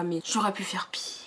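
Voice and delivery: soft whispered confession; whispered aside. Real, not acted. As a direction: whisper it like a guilty secret. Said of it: "confiding aside". (murmuré en confidence)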